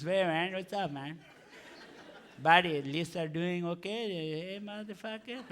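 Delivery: put-on low, soft Indian-accented cool dude. Cool cab driver chatting to his passenger, Indian accent. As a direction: Indian accent, casual swearing that trails away quietly. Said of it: "got that accent".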